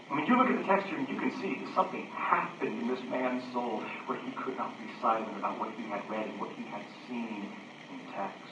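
low, soft aside; hushed preaching. Acted. Preacher points to the psalmist's stirred soul, hushed preaching.

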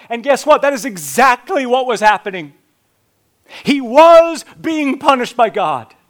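loud, high, impassioned sermon; preaching at full force. Acted. Preacher telling the congregation what everyone assumed, impassioned. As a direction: drive the point home at full voice.

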